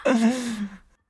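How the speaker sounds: embarrassed laughing protest. Real, not acted. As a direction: laugh it out, half groaning. Embarrassed about confessions, laughing.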